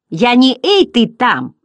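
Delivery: loud, indignant retort; Bastian angry. A narrator's reading. Boy to the rude butterfly, loud angry retort. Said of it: "по-настоящему рассердился Бастиан".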